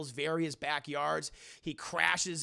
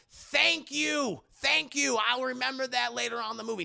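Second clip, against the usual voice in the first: high, emphatic thanks; Aaron Davis thanking; 6 semitones higher, 5 dB louder than usual. Retold or imitated. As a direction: imitate pointedly relieved gratitude. Aaron Davis acted, grateful.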